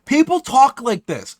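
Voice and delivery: slow, high and loud disbelief; incredulous. Real, not acted. Reacting to how the messages read, slow and incredulous. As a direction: stretch it out, baffled. Mocking the message's odd wording.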